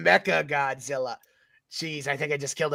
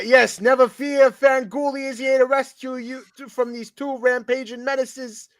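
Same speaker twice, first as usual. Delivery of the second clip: mock hero; 6 semitones higher than usual. Heroic announcement by Fanguli.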